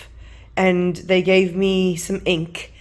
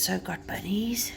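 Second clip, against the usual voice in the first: soft unvoiced whisper; 9 dB quieter than usual. Real, not acted. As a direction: whisper it like a secret. Whispers while showing stickers.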